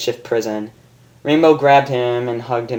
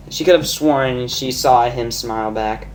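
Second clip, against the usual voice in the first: ordinary delivery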